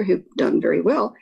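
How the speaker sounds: ordinary talk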